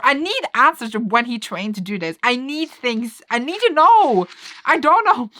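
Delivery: high, loud fangirl outburst; worked-up demanding. Real, not acted. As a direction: build to an exasperated high shout. Worked up over unanswered plot questions.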